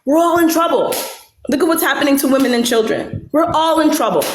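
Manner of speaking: mock warning. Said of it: "She said that".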